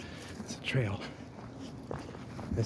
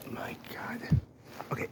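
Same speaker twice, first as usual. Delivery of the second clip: awed whisper; 8 dB quieter than usual. Real, not acted. Awe inside the canal.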